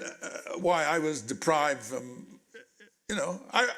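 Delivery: normal talking voice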